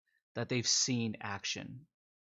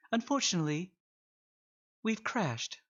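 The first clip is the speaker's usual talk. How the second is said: much higher, sing-song computer voice; chirpy ship computer; 8 semitones higher than usual. Acted. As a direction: pitch it way up like a cheerful AI.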